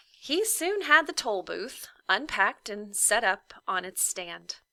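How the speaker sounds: ordinary delivery, nothing marked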